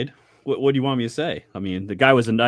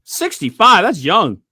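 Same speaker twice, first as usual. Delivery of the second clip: surprised; 8 semitones higher, 8 dB louder, slower than usual. Real, not acted. Surprised at an early death.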